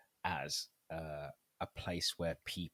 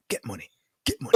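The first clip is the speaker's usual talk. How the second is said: loud, punchy hype chant; imitating a gym motivator; 5 semitones higher, 14 dB louder than usual. Man hyping his girl before she lifts, loud urging. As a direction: urge her on with a pushy, repeated catchphrase. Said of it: "he'd be like".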